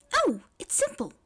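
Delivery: high, eager Mei voice; bright eager girl. A narrator's reading. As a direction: chirp it out eagerly and simply. Mei cheerfully explains herself.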